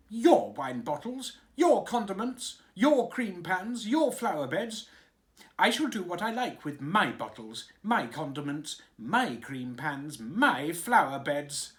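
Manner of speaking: loud, high furious tantrum; childish tantrum. A narrator's reading. King raging at his servants in a loud tantrum. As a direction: stamp it out like a spoilt child.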